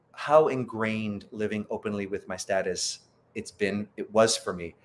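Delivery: ordinary talk, nothing marked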